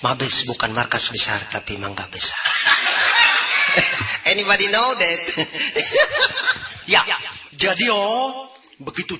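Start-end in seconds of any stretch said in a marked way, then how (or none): 2.4-4.2 very high, loud cackle; shrieking laugh. Real, not acted. Big laugh. 5.2-6.8 shrieking laugh. Real, not acted. Big laugh.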